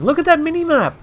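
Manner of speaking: thrilled, pointing at the map; excited. Real, not acted. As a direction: pitch jumps high with excitement. Excited gameplay commentary.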